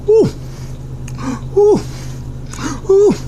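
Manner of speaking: short high oohs from the heat; spicy ooh. Real, not acted. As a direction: gasp at the burn. Reacting to heat.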